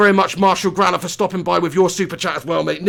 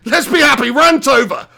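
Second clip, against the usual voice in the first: higher, louder and cheery; 5 semitones higher, 5 dB louder than usual. Real, not acted. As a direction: force a bright happy tone.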